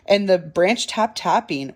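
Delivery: plain reading voice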